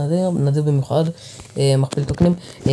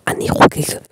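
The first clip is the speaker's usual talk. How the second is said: whisper. Real, not acted. Quiet celebration aside.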